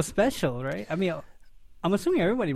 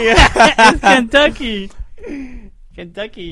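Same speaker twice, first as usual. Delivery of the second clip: laughing; 9 semitones higher, 11 dB louder, much slower than usual. Real, not acted. Laughing over the pet's name.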